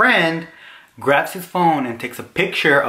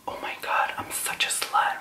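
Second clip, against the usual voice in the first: hushed, unvoiced mock horror; horrified whisper; 9 dB quieter than usual. Seeing hickeys in the restroom mirror, horrified whisper. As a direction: whisper it to yourself, aghast.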